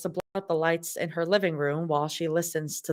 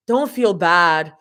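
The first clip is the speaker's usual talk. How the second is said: slow, louder sing-song call; 6 dB louder, slower than usual. Acted. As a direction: call it over the shoulder. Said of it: "calls out".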